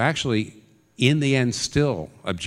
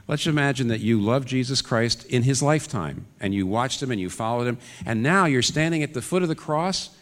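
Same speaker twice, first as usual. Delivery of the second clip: ordinary talk, nothing marked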